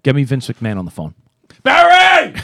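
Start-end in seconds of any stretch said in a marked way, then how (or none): 1.6-2.3 loud, high call across the office; shouting a name. Pretend. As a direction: yell for Barry to come. Mock shout for assistant.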